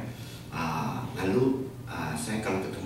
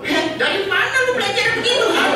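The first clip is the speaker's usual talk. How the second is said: very high, fast, loud, breaking into laughter; shocked laughing question; 13 dB louder, faster than usual. Retold or imitated. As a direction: squeal it out in amused shock. Pastor's shocked laughing reply.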